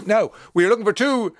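upbeat presenter selling the hotel prize; radio promo read. Acted. Radio host announcing the quiz prize, upbeat read.

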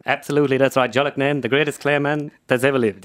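put-on voice of the hurling pundit; Ger Loughnane. Impressionist demonstrating his practised character, put-on pundit voice. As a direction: do the practised caricature. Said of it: "went home, started practicing them".

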